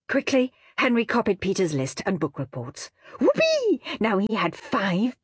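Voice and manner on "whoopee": very high, gleeful cheer; whoopee. A narrator's reading. Henry celebrating his copied list, gleeful. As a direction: whoop with delight.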